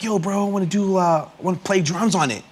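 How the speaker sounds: [name]. Retold or imitated. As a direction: pitch it up a little, pitching an idea to a bro. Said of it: "He's like".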